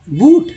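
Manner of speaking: बूट as "boot"; long oo in बूट; spelling-style "boot" for बूट; wrong reading. Shown as a demonstration. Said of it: "नहीं".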